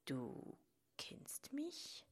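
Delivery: wary whispered question; suspicious. A narrator's reading. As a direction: ask softly, full of distrust. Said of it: "fragte die Traurigkeit misstrauisch".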